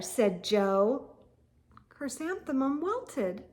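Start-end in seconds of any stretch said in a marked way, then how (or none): none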